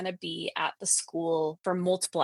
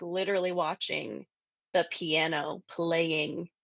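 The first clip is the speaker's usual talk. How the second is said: normal talking voice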